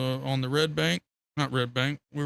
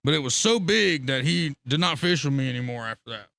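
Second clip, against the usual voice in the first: ordinary talk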